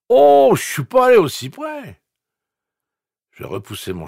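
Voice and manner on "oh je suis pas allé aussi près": much higher, louder, slow dopey slur; slurred voice. A narrator's reading. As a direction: drawl it like a dim frat bro. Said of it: "Voix pâteuse".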